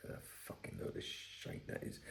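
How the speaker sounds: whispered under the breath; whispered aside. Real, not acted. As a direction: mutter it to yourself.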